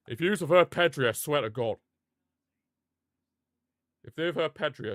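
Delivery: ordinary talk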